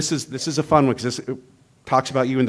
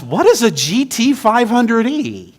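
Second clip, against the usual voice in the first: higher and louder, baffled question; a priest who has never heard of the car; puzzled priest; 10 semitones higher, 7 dB louder than usual. Retold or imitated. Priest asking the car owner, puzzled and loud. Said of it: "the priest goes".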